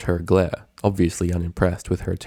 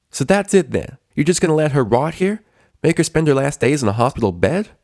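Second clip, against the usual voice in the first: louder, higher indignant challenge; angry protest; 8 semitones higher, 5 dB louder than usual. Acted. Friend confronts the doctor, loud and angry. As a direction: throw it at him, furious.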